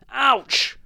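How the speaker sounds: high, loud yelp; sudden cry of pain; pained yell. A narrator's reading. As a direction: yelp as if bitten hard. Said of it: "yelled the man".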